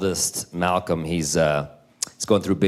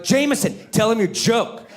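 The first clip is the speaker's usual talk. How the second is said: high-pitched, pushy parent voice; mock-stern prodding, pitched up; insistent parent; 11 semitones higher than usual. Parents voiced pressing the child.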